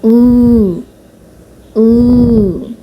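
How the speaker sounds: teacher models ऊ slowly and clearly; model vowel demo. Shown as a demonstration. Korean teacher demonstrating the vowel ऊ to students, clear and careful. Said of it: "इसे ऊ pronounce करेंगे".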